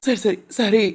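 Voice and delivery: usual speaking voice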